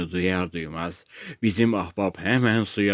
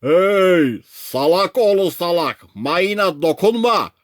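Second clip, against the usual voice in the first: high, loud warning holler; megaphone shouting; 9 semitones higher, 4 dB louder, slower than usual. A narrator's reading. Crew orders him off the mine, megaphone shouting. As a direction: bawl an insulting order across the water. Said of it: "megafonla bağırmaya devam ettiler".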